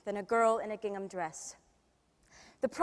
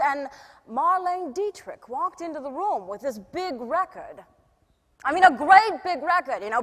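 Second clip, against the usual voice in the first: theatrical star telling an anecdote; lively, showy raconteur; 9 dB louder than usual. Acted. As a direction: greet the crowd grandly, then spin the story. Plays [name] in a monologue.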